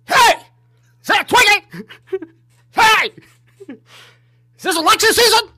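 hammed-up high, loud heckling shouts; mock yelling. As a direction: scream like an outraged heckler at the polls.

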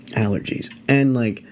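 ordinary delivery

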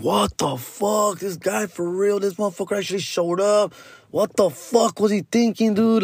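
high, slow, drawn-out disbelief; tripping out. Retold or imitated. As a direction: retell the group's stunned reaction, stretching the words. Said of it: "we're all just tripping out".

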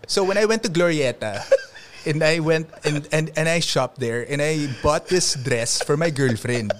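lightly done Makati English twang; a hint of rich Makati kid talk; Makati accent. Shown as a demonstration. Showing friend his Makati accent, lightly voiced. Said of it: "may Makati accent ako".

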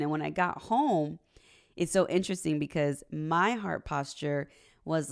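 ordinary delivery

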